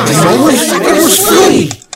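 high, loud, slow reveal; dramatic confession. Acted. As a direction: belt out the confession.

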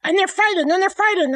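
ordinary talk

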